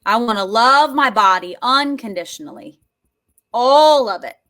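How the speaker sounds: loud, wholehearted declaration; emphatic affirmation. Shown as a demonstration. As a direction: declare it with full conviction. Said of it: "Are you ready for this?".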